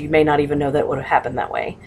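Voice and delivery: normal talking voice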